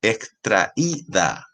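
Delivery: extraída very slow, with í held apart from tra; slow teaching extraída, stress on í; syllable by syllable. Shown as a demonstration. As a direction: break extraída into slow, separate syllables for a class. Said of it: "tra-, extraída, es un hiato".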